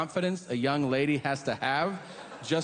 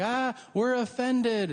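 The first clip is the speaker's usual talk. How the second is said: mock offended San Franciscans; 6 semitones higher, slower than usual. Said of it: "They're like".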